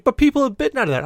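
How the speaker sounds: fast, high, loud objection; disgusted disbelief. Retold or imitated. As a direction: blurt it out appalled, pitched high. Brother objects to bitten food.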